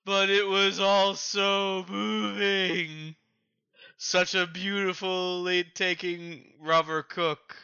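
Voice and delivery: blubbering. Acted. Cook sobbing over the rubber captain, blubbering. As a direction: weep through the words. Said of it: "through that blubber talking".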